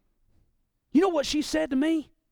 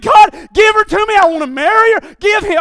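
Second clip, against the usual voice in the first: begging prayer; 8 semitones higher, 11 dB louder than usual. Retold or imitated.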